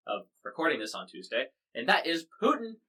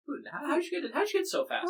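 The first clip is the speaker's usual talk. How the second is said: very high-pitched mock astonishment; incredulous questioning. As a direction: squeak out the acted, baffled questions. Voicing the public questioning Putin.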